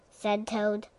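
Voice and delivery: plain reading voice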